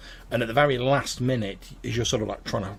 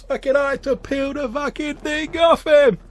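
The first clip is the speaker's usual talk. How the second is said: put-on much higher, rowdy drunk telling tales; drunk storyteller; 8 dB louder than usual. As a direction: bellow an embarrassing story at him. Drunk man ends story.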